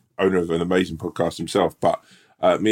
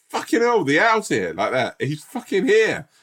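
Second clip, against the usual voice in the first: much higher, hammed-up relieved cheer; mock soldiers cheering; 12 semitones higher than usual. Soldiers greeting their rescuer, high mock relief. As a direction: squeal with relief that help came. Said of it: "we're like".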